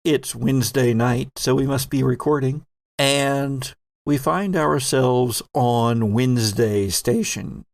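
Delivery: usual speaking voice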